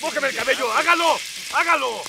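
high, loud yelling; shouting. Acted. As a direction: yell over the cold water. Ordering hose on hair, cold.

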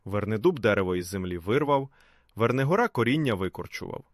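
ordinary delivery, nothing marked

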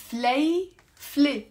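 فليفلة said slowly and clearly, fle-fle; careful pronunciation. Shown as a demonstration. Teacher showing learners how to say it, slow and clear. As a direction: spell out فليفلة slowly in two syllables. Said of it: "that's how you pronounce it".

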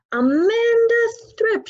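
high, loud bellowing shout of a name. Acted. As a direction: yell the girl's name across the yard. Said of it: "she was shouting".

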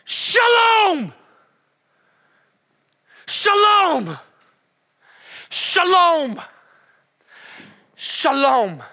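booming Shalom! fading with each call; Jesus shouting Shalom! at the waves; commanding shout. Acted. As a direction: command the storm to be still. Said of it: "he goes".

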